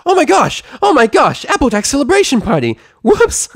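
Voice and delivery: very high, loud squeals; sudden giddy yelling; exploding excitement. Acted. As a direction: burst out in a frantic shriek. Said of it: "as did her voice".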